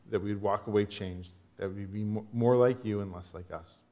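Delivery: low, hushed pastoral prayer. Real, not acted. Pastor asks God to open up passage, low prayer. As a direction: pray earnestly, voice dropped low.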